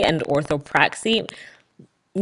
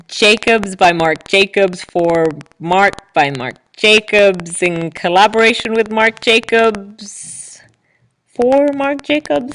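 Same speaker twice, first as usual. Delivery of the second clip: slow, louder mock luxury-ad voice dropping to a whisper on the collaboration line; mock fashion ad; 4 semitones higher, 6 dB louder, slower than usual. Podcaster mocks the Marc Jacobs clothing image, slow hammed-up luxury-ad voice. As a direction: intone every Marc Jacobs slowly like a designer perfume ad. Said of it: "It was like".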